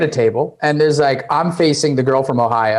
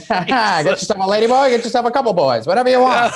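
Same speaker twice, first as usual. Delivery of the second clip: high street-hawker patter; hammed-up newsboy sales pitch; old-timey newspaper salesman; 8 semitones higher than usual. Mock newsboy touting to passers-by, old-timey hawker. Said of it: "I sound like an old timey newspaper salesman".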